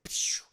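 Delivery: voiceless "pshh" of spikes firing; whooshing sound effect. Retold or imitated. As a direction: imitate a shot with hissing air. Imitates spikes shooting out.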